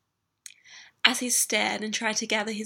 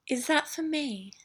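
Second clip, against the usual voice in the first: higher, teasing purr; 6 semitones higher than usual. Acted. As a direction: purr it seductively. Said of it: "she purred".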